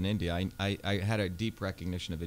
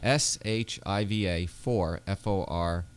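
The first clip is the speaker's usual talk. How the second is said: slow, letter-by-letter spelling; deliberate dictation, one letter at a time; 3 dB louder, slower than usual. Shown as a demonstration. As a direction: spell it slowly for listeners to note down. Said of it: "spell it again for the folks at home".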